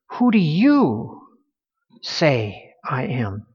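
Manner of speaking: who do YOU say I am, slow with YOU leaned on; stress on you. Shown as a demonstration. Preacher to the congregation, slow stress on YOU. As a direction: push hard on the word "you". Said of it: "there is an emphasis on the word "you".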